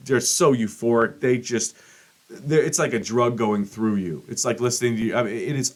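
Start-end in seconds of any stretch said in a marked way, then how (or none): none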